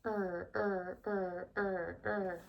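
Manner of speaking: soft rhythmic er er rowing noise; paddling sound. Shown as a demonstration. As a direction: quietly chant the oar sound. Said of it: "see if you guys can make that sound".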